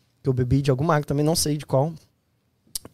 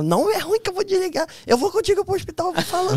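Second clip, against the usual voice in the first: very high mock female voice; insistent woman in squeaky imitation; imitated woman caller. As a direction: put on a falsetto, refusing to hang up.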